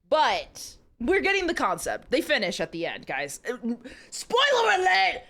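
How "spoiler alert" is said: gleeful shout. Real, not acted. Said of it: "shouting a joke to chat".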